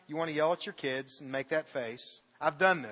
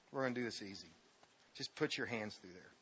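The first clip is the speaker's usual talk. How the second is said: murmured aside; 8 semitones lower, 13 dB quieter than usual. Real, not acted. Quiet aside to volunteer.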